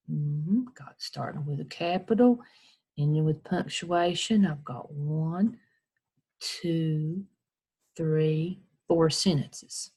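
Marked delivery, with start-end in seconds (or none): none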